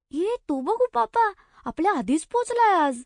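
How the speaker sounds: high, eager "look, there he is"; excited spotting a friend. Acted. Girl spots Upapa and excitedly tells her friends. Kids spot Upapa ahead.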